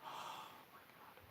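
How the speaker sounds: breathed out under a sigh; whispered oh my God. Real, not acted. Remembering his sister who died, real whispered sigh. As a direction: let it slip out in a whisper. Grief recalling sister's death.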